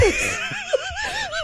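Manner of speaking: high shrieking laugh. Real, not acted. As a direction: burst out laughing. Cracking up at attempt.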